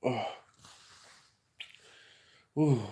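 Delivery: normal talking voice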